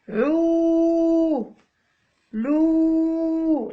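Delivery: long held عو and لو; drawn-out syllables. Shown as a demonstration. Teacher modelling syllables one by one, held long. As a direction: stretch each syllable like a model. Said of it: "عو تولي".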